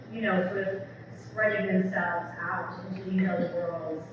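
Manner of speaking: ordinary delivery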